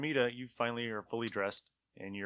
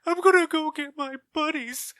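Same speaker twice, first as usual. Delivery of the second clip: high, whiny, blubbering voice of a beaten thug; crying thug; 9 dB louder than usual. Acted. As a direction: wail it like a terrified loser begging. Thug voice again, fleeing.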